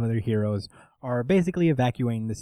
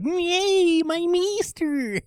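higher, slow, gushing squeal; mock fanboy; much slower than usual. Mocking Doctor Dharma as a simp, gushing squeal. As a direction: gush like a starstruck sidekick. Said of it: "just like".

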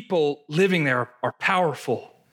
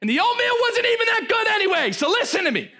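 Caleb shouting. Retold or imitated. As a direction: shout the crowd down. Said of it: "Caleb's like".

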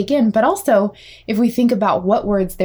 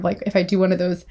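ordinary talk, nothing marked

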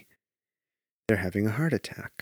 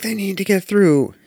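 put-on higher, louder bossy kid; kid's announcement; 11 semitones higher, 7 dB louder than usual. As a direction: call it out like the kid. Retelling the kid's call.

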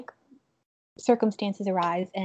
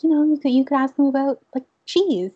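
higher, faster, louder eager voice; eager suggestion; 6 semitones higher, 5 dB louder than usual. Retold or imitated. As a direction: blurt out the idea brightly. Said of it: "she's like".

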